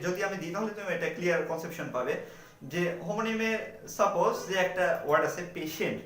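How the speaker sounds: ordinary talk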